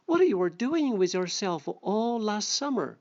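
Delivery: a lightly voiced storybook reading; story reading. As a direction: read the fable aloud to the class. Second read of the fable.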